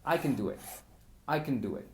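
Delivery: "can" reduced to "kn" inside the phrase; fast schwa can. Shown as a demonstration. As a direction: say it quick with a weak "can". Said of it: "no suena como can".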